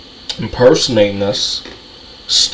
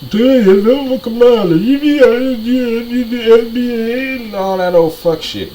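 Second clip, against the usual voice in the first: mocking voice; 10 semitones higher than usual. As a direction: mimic them pitched up and dismissive. Mocking imagined voice.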